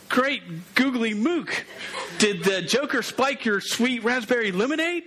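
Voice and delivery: high-pitched incredulous sidekick; Robin. Acted. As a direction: pitch it up in comic disbelief at the boss. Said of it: "And he'd be like".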